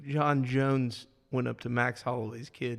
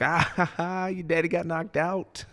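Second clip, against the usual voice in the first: higher, put-on jeering with a fake laugh; mock taunting; 4 semitones higher than usual. Imagined fighter clowning a rival's kid backstage, mock taunting. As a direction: play at gloating over someone. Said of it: "come up backstage and be like".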